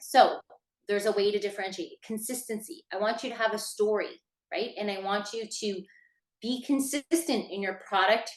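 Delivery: ordinary talk, nothing marked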